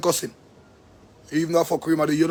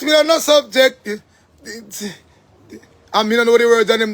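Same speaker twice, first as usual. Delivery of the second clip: higher, louder, slowed mock outrage; 3 semitones higher, 3 dB louder, much slower than usual. Ranting about a dunce song, mock outrage slowed. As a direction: drag it out in disbelief. Mocking rant about the class.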